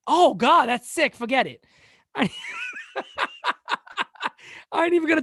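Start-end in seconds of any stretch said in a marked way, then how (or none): none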